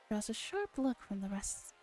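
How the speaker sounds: ordinary talk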